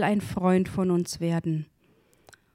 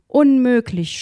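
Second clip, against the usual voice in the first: loud, higher cry of dismay; narrated shout; 4 semitones higher, 6 dB louder than usual. A narrator's reading. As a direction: exclaim it forcefully as the story's man. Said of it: "schrie er".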